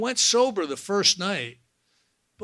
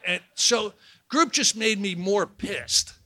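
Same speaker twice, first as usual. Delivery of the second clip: ordinary talk, nothing marked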